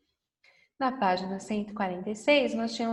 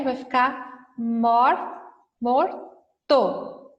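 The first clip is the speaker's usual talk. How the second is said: slow and clear; 4 dB louder, much slower than usual. Shown as a demonstration. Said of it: "Se eu coloco o R".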